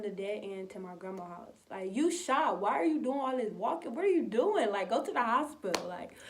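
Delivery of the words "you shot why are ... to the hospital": higher, louder pleading scold; exasperated scolding. Retold or imitated. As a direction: scold her into getting help. Family scolding shot mom.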